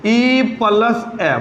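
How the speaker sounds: ई plus ए sounded out slowly, sounds blended; slow sounding out. Shown as a demonstration. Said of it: "बोल के देख".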